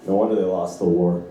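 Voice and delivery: low, flat one-liner; mild deadpan father voice; deadpan dad. Retold or imitated. Comedian imitating his deadpan father's one-liner. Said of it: "this deadpan, emotionless".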